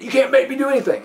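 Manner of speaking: high, quick mock defiance; defiant retort. Suspect refusing to obey officer, defiant retort. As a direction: push back fast and high.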